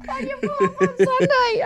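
high, giggling retelling; can barely get the words out for laughing; laughing through it. Real, not acted.

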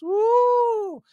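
sarcastic falsetto whoop; high, loud sarcastic woo; mocking high woo. Real, not acted. Mocking the empty threats.

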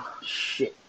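breathy under-the-breath curse; whispered dread. Retold or imitated. Cursing quietly while held by the neck. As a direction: mutter it so he can't hear. Trapped by thug in room.

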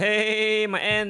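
high, loud taunting call; loud mocking call. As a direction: yell a mocking greeting. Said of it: "and like".

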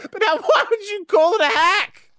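high, laughing complaint. Real, not acted. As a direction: whine it through laughter.

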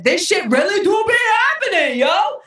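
thrilled high exclamation; excited. Real, not acted. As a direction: shout it out in delight. Excited about manifesting.

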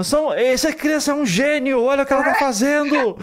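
put-on proud parents gushing over baby. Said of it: "a gente fica falando".

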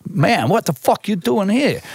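high, drawn-out disbelief; incredulous street guy. Retold or imitated. New York stranger grabs a lost visitor, incredulous street guy. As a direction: challenge a stranger who does not belong.

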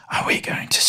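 soft unvoiced whisper; proper whisper. Shown as a demonstration. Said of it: "If you're gonna whisper, you have to whisper".

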